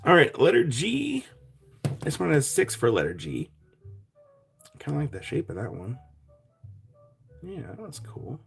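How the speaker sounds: ordinary delivery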